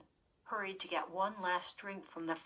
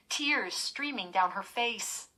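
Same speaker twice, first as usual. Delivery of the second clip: ordinary delivery, nothing marked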